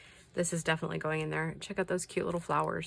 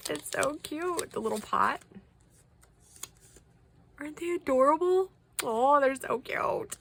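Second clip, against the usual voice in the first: adoring gushing; 9 semitones higher than usual. Real, not acted. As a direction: melt over how cute it is, pitched high. Cooing over tiny gifts.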